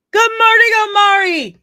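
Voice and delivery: very high, loud sing-out hello; excited greeting. Real, not acted. Streamer breaking off to greet a viewer, excitedly. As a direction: call it out sky-high and loud.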